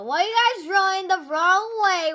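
loud, high protest; exasperated complaint. Real, not acted. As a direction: call out to teammates in exasperation. Complains to players going wrong way.

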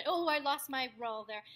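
ordinary talk, nothing marked

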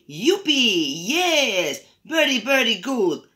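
high whoop of triumph; excited storybook cheer; joyful shout. A narrator's reading. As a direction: shout it happily, drawn out and high. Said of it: "shouted Fede, grita Fede".

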